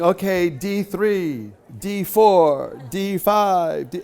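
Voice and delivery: imitating a slow, drawn-out roll call; calling out positions. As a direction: stretch each call out like an announcer reading off spots. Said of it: "there's somebody going".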